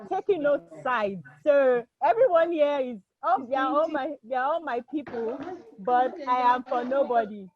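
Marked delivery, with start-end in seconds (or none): none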